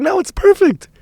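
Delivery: very high, mock-cheerful reassurance; squeaky cover-up. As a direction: squeak it out as if all is fine. Covering up his blunder brightly.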